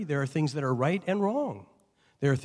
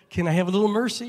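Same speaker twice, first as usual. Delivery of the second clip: raised, fast plea for mercy; begging; 6 semitones higher, 5 dB louder, much faster than usual. Retold or imitated. Driver begging the officer for mercy, quick and raised. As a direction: wheedle a little.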